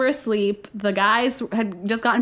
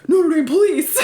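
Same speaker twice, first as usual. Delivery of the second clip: police announcement; 6 semitones higher, 6 dB louder than usual. Retold or imitated. Officers announce themselves.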